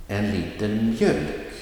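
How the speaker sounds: light on En liter, lean on mjölk; stress on mjölk. Shown as a demonstration. Teacher models an example phrase, stress on mjölk. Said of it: "the noun will get the emphasis".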